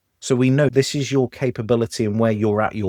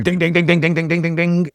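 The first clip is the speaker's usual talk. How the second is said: rapid, high run of dings; imitated bell ringing; 7 semitones higher, 4 dB louder than usual. Chef hammering the pass bell at a waiter, imitated bell ringing. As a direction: ring it out like an insistent pass bell. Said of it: "the chef ringing the pass bell".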